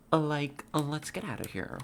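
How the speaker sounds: put-on high-pitched Valley Girl; mock Valley Girl voice. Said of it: "You want the Valley Girl voice?".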